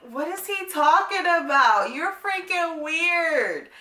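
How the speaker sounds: high-pitched, drawn-out exasperation; incredulous outburst. Retold or imitated. Woman retelling her reaction to a man's odd texts, high incredulous whine. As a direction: wail it in mock disbelief, stretching the last words. Exclaims at his baffling texts.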